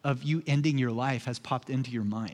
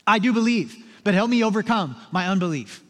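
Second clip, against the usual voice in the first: loud, raised declaration of faith; imitating a father's cry; 4 semitones higher, 4 dB louder than usual. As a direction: call it out urgently, as a plea. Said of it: "The Father instantly cries out".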